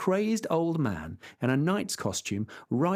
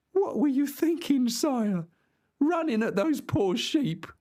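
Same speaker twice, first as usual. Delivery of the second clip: much higher, scolding farmhand; exasperated squire; 11 semitones higher than usual. Acted. Squire scolding his fallen master, high and exasperated. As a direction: scold him, pitched high. Sancho's voice.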